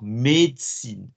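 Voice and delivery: "Médecine" drawn out slowly, syllable by syllable; slow careful médecine. Shown as a demonstration. Teacher warning the class about "Médecine" with a slow model. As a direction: spell out "Médecine" in sound, slowly. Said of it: "Be very careful of words".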